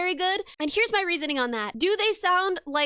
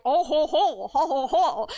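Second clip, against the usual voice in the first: lower, mock-French chortle; mocking French laugh; 3 semitones lower than usual. Friends teasing her about French accents, mock French laugh. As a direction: imitate a hammed-up French "oh ho ho". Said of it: "they were just like".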